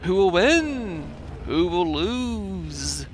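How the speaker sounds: slow, drawn-out dramatic questions; dramatic narrator. Pretend. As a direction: savor each question slowly.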